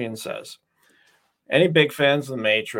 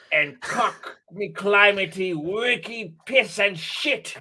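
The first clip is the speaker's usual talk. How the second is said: slow, hammed-up villain lament; mock-grand speech with high shrill peaks; overacted villain mourning lost style; Merovingian rant; 7 semitones higher than usual. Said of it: "So what does he say?".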